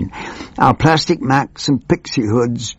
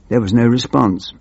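normal talking voice

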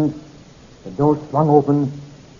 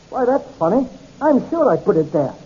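higher-pitched, puzzled surprise; bewildered; 6 semitones higher than usual. Acted. Captain missing the glass he put there, bewildered. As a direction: sound mildly thrown by the discovery. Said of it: "His bewilderment was interrupted".

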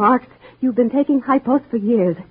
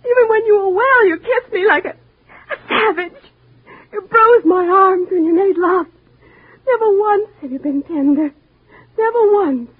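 tearful accusation; 9 semitones higher than usual. Acted. Wife accuses brutal husband.

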